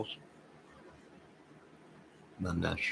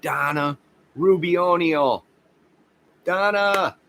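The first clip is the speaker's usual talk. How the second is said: excited greeting; 10 semitones higher, 5 dB louder, slower than usual. Real, not acted. Greeting a viewer who just arrived.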